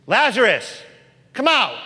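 loud, high shout into the tomb; commanding call. Acted. Jesus calling Lazarus from the tomb, a loud high shout. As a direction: call the dead man out with full voice.

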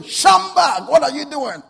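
very loud, high shout; booming angel's wake-up call; rousing shout. Acted. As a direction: shout a sleeper awake. Acting angel shaking sleeper awake again.

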